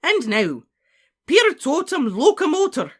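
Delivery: high, loud incantation; ringing, forceful spell-casting shout; crying out. A narrator's reading. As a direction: cry the spell with full command. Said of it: "cried Professor McGonagall".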